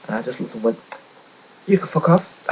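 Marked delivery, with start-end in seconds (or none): 1.7-2.5 high, loud mock blow-up; retold outburst. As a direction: fling it at him, loud and high. Retold telling off the agency.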